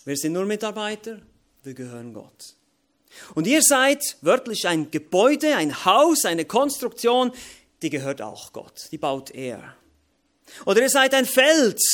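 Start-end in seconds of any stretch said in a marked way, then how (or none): 3.3-11.8 louder, higher preaching bursts; emphatic preaching. Acted. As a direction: proclaim it to the church. Preaching at full force.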